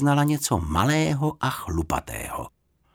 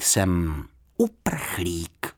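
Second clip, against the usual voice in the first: šeptem; 4 semitones lower, much slower than usual. A narrator's reading. Bear confesses secret.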